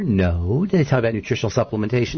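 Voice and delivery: ordinary delivery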